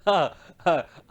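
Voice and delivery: ordinary talk, nothing marked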